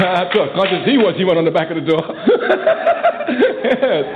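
chuckling through the words; laughing. Real, not acted. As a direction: laugh it off between phrases. Laughing at own story.

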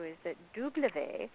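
"W" said the French way, as "doo-bluh-vay", slowly; French letter W. Shown as a demonstration. Caller showing the host how French says the letter, slowly. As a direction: spell out "W" in French, drawn out and careful. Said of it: "is the French pronunciation for the letter W".

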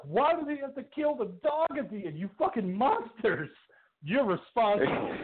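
high, slow, mock-wailing outrage; a heartbroken moviegoer cursing the filmmakers, high and slow. Mock outrage. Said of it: "I was like".

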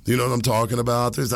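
ordinary talk

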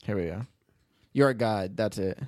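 ordinary talk, nothing marked

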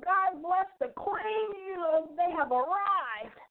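mocking. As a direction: lampoon their self-satisfied pride.

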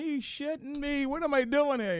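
high-pitched exasperated lament; mock-miserable high whine; acted-out disbelief. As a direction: complain in disbelief at your own lot. Acts out his own dismay.

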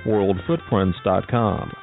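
usual speaking voice

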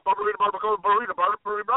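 "barbarita, barbacoa, burrito" rattled off fast without pauses; fast tongue twister. Shown as a demonstration. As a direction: race through "barbarita, barbacoa, burrito" on repeat. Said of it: "Can you say that five times fast?".

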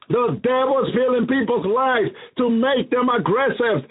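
usual speaking voice

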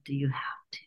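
reluctant whispered protest; pleading to the teacher in a whisper; whispered plea. Retold or imitated. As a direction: beg off in a whisper. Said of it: "And I'm like".

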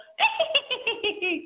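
high cartoon cackle; high-pitched demo cackle. Shown as a demonstration. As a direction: let out a silly witchy cackle. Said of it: "Giggle a lot and cackle".

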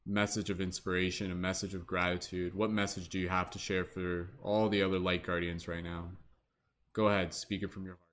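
calm guide easing everyone inward; guided meditation. Acted.

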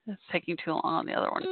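ordinary talk, nothing marked

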